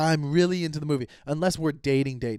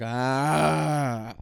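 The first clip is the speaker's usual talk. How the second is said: long drawn-out "ahh" of awe; imitated audience gasp. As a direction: mimic a whole theater going "ahh". Imitates audience reacting to preview.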